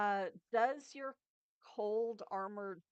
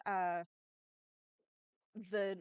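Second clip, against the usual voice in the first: usual speaking voice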